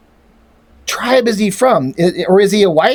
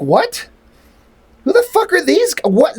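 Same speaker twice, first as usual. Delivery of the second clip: baffled; 8 semitones higher than usual. Retold or imitated. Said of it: "I'm like".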